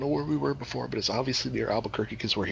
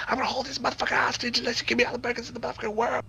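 high, fast put-on robber voice; high quick mock holdup threat; robber demand; 13 semitones higher, faster than usual.

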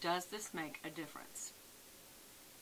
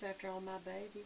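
normal talking voice

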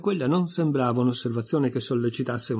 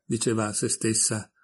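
ordinary delivery

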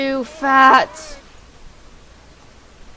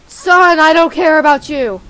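loud, raised-pitch mock-angry shout; dad yelling back; 3 semitones higher, 9 dB louder than usual. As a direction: brush the son off at full volume. Dad yells back at son.

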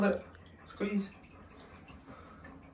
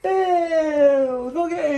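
high excited shout; shouting for the dog; 8 semitones higher, 8 dB louder, much slower than usual. Real, not acted. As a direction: call out his name loud and high. Calling the dog out loud.

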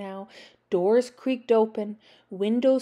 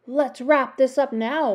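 ordinary delivery, nothing marked

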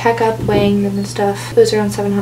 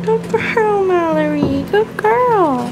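high, sing-song praise for a pet; sweet praise; 8 semitones higher, slower than usual. Real, not acted. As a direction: praise a good kitten sweetly. Praising kitten at the vet.

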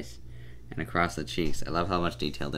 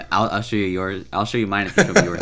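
laughing; 3 semitones higher, 6 dB louder than usual. Acted. Joking line, laughing.

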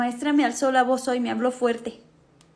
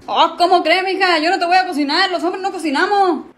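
macho scoff; 4 semitones higher, 4 dB louder than usual. Acted. Papá machista se niega.

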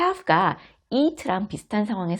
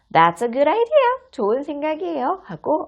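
put-on high, louder cheerful child; 10 semitones higher, 3 dB louder than usual. Child agrees to sit by mom, high bright voice. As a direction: answer like a happy little kid.

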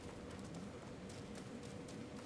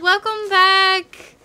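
high, loud, drawn-out call; excited greeting; 8 semitones higher, 12 dB louder, much slower than usual. Real, not acted. Streamer greeting a returning viewer, excited drawn-out shout. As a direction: call it out slowly and loudly.

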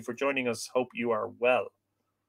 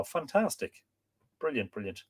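usual speaking voice

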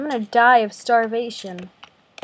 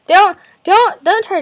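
sudden loud high yell; shouting; 8 semitones higher, 12 dB louder, slower than usual. Real, not acted. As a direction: yell to cut someone off. Yelling at stepsister.

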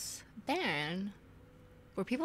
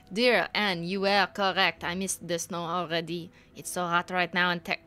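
put-on letter read in a mistaken French accent; 4 dB louder than usual. Friend writing to Anne from Texas, wrongly in French accent. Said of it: "this is American".

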